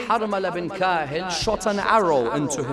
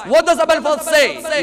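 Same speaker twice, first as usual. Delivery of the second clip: ordinary delivery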